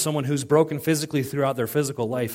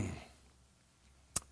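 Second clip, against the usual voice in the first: soft breathy mm over a steak; savoring mm; 4 semitones lower, 21 dB quieter than usual. Real, not acted. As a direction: hum like tasting something delicious. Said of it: "Anybody else drooling?".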